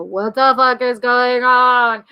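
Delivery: put-on slow, drawn-out bewildered drunk voice; mock drunken astonishment, dragged out; drunk partygoer. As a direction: imitate a baffled drunk, stretching every word. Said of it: "see all the drunk people go".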